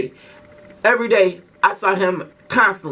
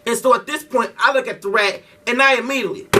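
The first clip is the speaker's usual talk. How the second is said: ordinary talk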